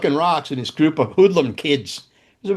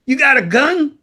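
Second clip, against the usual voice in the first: put-on Aussie kid asking; 11 semitones higher, 3 dB louder than usual. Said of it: "he goes, he goes".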